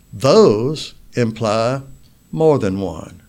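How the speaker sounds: slow, emphatic weight on "those"; stress on THOSE. Shown as a demonstration. Preacher contrasting plural with singular, stress on "those".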